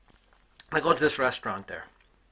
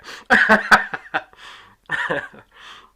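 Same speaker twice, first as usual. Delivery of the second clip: loud laugh; 7 semitones higher, 15 dB louder than usual. Real, not acted. Laughing at his own punchline.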